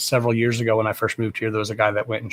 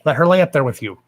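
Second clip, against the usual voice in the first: higher, sharp scolding; scolding boyfriend; 5 semitones higher, 4 dB louder, faster than usual. Retold or imitated. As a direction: snap at him to leave the dog be. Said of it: "I'm like".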